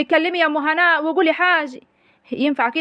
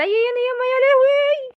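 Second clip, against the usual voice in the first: shrieking wail; 10 semitones higher than usual. Acted. Mother shrieking in grief at her battered children, wailing. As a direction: let out a high piercing lament.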